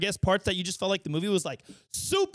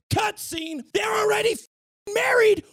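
outraged shout; 12 semitones higher, 4 dB louder than usual. Real, not acted. Outrage at skipped wedding.

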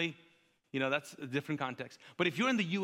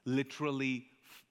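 ordinary talk

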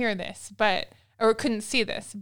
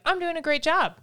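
self-pleased parent; 7 semitones higher than usual. Retold or imitated. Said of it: "sometimes that feels like".

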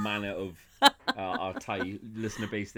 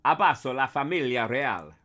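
louder Italian line with Italian hands; 3 semitones higher, 5 dB louder than usual. Shown as a demonstration. Italian, announced for Stephen Root. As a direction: proclaim it in Italian. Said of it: "you're gonna get Italian".

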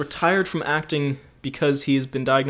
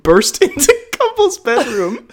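laughing while reading; 9 semitones higher, 6 dB louder, slower than usual. Real, not acted. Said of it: "Sorry, sorry".

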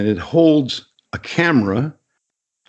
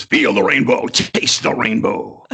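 Skittles announcer line. Shown as a demonstration. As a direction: deliver it like the ad's closing tag. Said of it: "I'm the guy at the end who says".

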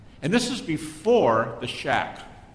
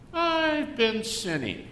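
slow, high, sheepish admission; mock confession; 9 semitones higher, much slower than usual. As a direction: drag it out like a guilty confession. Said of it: "How about this one?".

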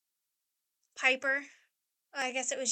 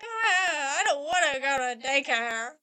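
hammed-up tearful whine; crying child; 2 semitones higher, 6 dB louder, slower than usual. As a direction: imitate a little boy bawling. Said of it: "Morris is like".

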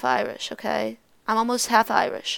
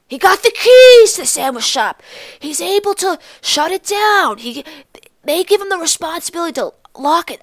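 loud, higher, gushing proud aunt voice; bragging aunt; 5 semitones higher, 10 dB louder than usual. Retold or imitated. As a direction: brag about her son to the whole party. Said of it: "she'll be making".

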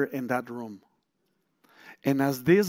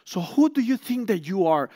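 retold scolding, somewhat higher; mock lashing out; 5 semitones higher, 3 dB louder than usual. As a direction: challenge him with contempt. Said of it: "started to lash on me".

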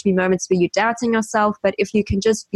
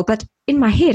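normal talking voice

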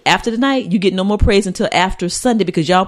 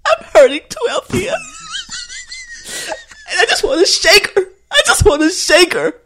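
very high mock sobbing, half laughing; tearful acting. Acting out a hurting character for the co-host, high hammed-up crying. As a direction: squeal it through put-on tears. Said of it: "I see them tears coming out".

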